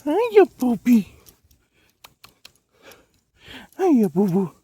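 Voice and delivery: high, slow sing-song puppy talk. Real, not acted. Greeting a dog sweetly.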